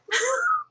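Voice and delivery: high squealing laugh; real giggle fit; high burst of laughter. Real, not acted. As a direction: break into a shrieking giggle. Laughing too hard in bed.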